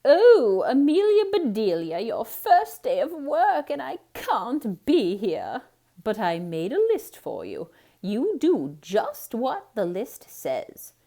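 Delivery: high, loud, slow lady of the house; Mrs Rogers voice. A narrator's reading. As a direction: instruct like a fussy employer.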